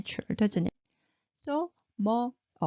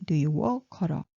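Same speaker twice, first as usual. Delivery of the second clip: ordinary talk, nothing marked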